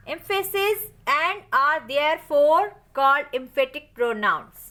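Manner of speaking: very slow, word by word, for students to write down; teacher's slow dictation of a rule. Shown as a demonstration. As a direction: spell it out slowly as if for a notebook. Dictates the definition for notes.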